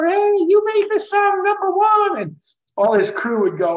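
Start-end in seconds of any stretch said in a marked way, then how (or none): 0.0-2.2 much higher rock-star stage shout; Paul's stage voice. Retold or imitated. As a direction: mimic Paul hyping the crowd from stage. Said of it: "Paul used to come out every night and go".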